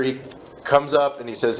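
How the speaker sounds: usual speaking voice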